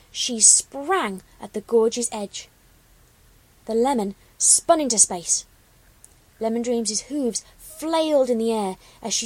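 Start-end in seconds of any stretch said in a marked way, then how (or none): none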